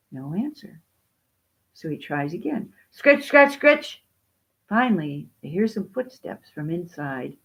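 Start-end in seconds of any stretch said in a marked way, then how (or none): 2.9-4.0 high, punchy scritch-scratch; sharp, raised onomatopoeic scratching; insistent scratching words. A narrator's reading. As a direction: make the words scrape like eager claws. Dog scratches again, more insistently.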